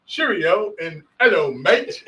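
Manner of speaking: put-on playful muddle of English and Australian accents; mixed English-Australian accent. Co-host greets listeners after his introduction, mock English-Australian accent. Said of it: "I just mixed my Australian accent with my English accent".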